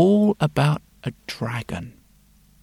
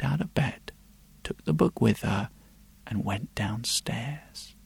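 whispering; 4 semitones lower, 12 dB quieter than usual. A narrator's reading. Sneaking downstairs at night.

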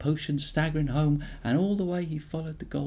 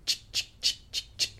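chick spray sound. Acted. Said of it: "He'd sneak up behind her and go".

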